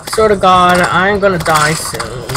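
ordinary talk, nothing marked

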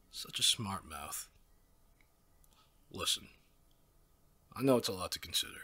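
usual speaking voice

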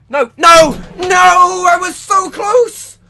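high loud slow wail of near-miss; anguished shout. Real, not acted. Gamer missing the chem strike by one kill, anguished shout. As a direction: cry out in agony at the loss.

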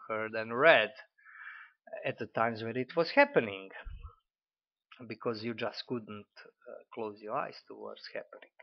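usual speaking voice

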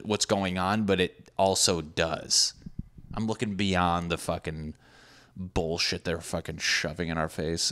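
usual speaking voice

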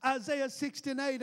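ordinary talk

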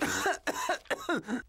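dry sick cough. Acted. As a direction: cough weakly while ill. Clarence enfermo tose. (tos de enfermo)

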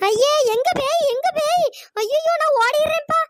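loud, high frightened outcry; high-pitched comic terror; panicked. Acted. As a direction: blurt it out ready to bolt. Panics and runs from ghost.

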